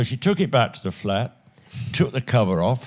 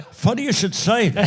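laughing; 10 semitones higher than usual. Retold or imitated. Quoting himself, laughing.

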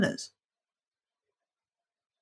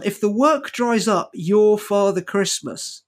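slow punchline; slower than usual. Shown as a demonstration. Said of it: "repeats the punchline".

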